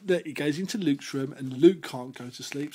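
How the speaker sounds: ordinary delivery, nothing marked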